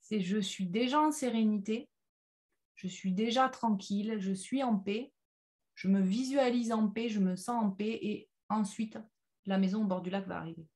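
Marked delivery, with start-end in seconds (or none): none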